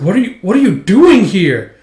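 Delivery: stammered start, then incredulous demand; acted bewilderment. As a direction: act baffled and alarmed. Retold shocked question at reunion.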